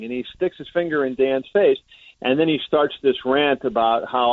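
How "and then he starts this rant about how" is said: ordinary talk, nothing marked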